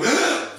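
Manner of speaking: quick high gasp for air; sharp gasp in. Shown as a demonstration. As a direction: suck in a big gulp of air. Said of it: "is easier if you go".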